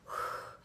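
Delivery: long unvoiced exhale letting it out; long breathy sigh of release; big release sigh. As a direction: breathe it all out.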